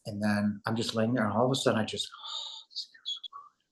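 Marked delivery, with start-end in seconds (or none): 2.1-3.7 soft whispered sigh of relief; blissful relief. Retold or imitated. Lying on the couch in sudden relief, whispering blissfully.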